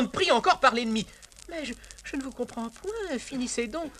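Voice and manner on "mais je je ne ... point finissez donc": high, soft, mock-flustered countess. Valet voices countess, high and soft.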